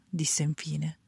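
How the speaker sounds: usual speaking voice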